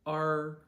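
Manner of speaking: R as the letter's name, high and level; first tone. Shown as a demonstration. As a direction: hold R loud on one high note. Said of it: "the name of the letter R".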